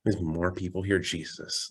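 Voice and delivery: softly grumbled imitation of exasperation; mildly irritated demon. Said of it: "he gets very irritated".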